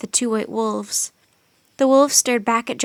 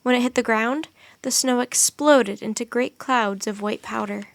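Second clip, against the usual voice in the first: usual speaking voice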